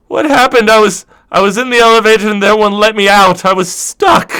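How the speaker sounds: put-on a rattled young crewman blurting out his complaint; flustered high voice. Kestrel complains about being stuck.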